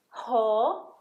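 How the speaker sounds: ordinary delivery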